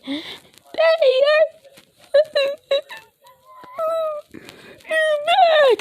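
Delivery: a hint of tearful squeal on you're; crying. Eri welcoming Deku home, mild tearful cry. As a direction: voice cracking up into tears. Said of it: "Starting to cry up a storm".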